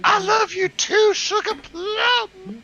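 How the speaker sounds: put-on gushing girl. Girl's gushing reply.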